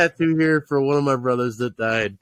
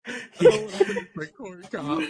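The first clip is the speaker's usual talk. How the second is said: put-on very high squeaky little-kid whine; whiny kid voice. Cousin kid whining at the kids' table, high and squeaky.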